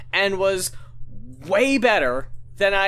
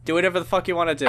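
rushed shrug; 7 semitones lower, much faster than usual. Retold or imitated. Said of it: "and I'm like".

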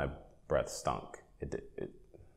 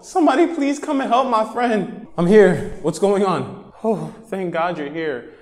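high, urgent voice of a friend; panicked friend; 12 semitones higher, 4 dB louder than usual. Acted. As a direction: beg a stranger to help your friend.

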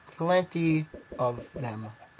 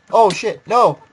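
alarmed shout; 6 semitones higher, 12 dB louder, much slower than usual. Real, not acted. Sudden loss in game.

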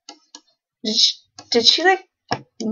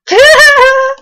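loud high laugh; 10 dB louder than usual. Real, not acted. Player laughing at her embarrassed Sim, loud high burst of laughter.

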